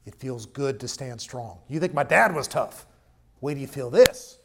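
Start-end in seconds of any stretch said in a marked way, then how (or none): 1.7-4.4 loud, defiant taunt with an odd edge; indignant king. Acted. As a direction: sneer it down at the crowd. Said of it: "he spoke in indignation".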